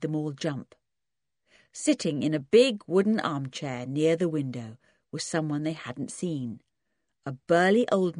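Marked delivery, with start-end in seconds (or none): none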